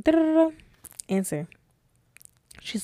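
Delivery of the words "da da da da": high sung-out ringtone noise; imitated phone ring. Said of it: "I hear the phone ring".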